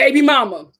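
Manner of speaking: ordinary delivery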